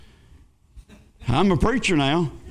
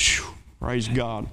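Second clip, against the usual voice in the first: soft, slow, low breath of relief; relieved sigh; 7 semitones lower, 6 dB quieter, much slower than usual. Real, not acted. Preacher hearing from the bank that the check cleared, soft and slow with relief. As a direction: let the worry drain out.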